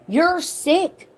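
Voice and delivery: high, sharp cry of disgust; disgusted outburst. Real, not acted. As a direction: throw the words at her in disgust. Direct outburst at the mom.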